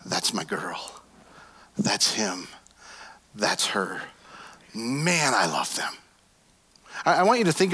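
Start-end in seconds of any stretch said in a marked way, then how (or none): 0.1-5.9 tender God voice. Pretend. God looking at his children, tender and hushed. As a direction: lower and softer, near a whisper. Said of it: "He looks at you and he goes".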